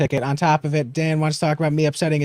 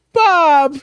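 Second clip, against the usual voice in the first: calling out; 11 semitones higher, 3 dB louder than usual. Retold or imitated. Calling dog outside house.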